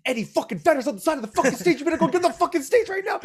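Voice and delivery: high, fast, urgent yelling; worked-up singer. Retold or imitated. Singer urging the nervous bandmate to stage dive, worked-up. Retelling singer hyping him up.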